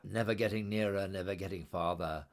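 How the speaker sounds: ordinary delivery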